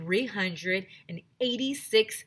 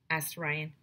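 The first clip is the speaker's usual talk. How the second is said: usual speaking voice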